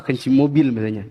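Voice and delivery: ordinary talk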